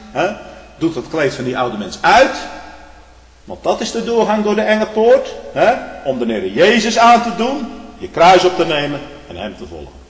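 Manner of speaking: loud and high, full pulpit force; loud, rising sermon cry; preaching the Lord's call. Retold or imitated. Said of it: "hij zegt".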